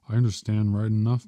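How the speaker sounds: plain reading voice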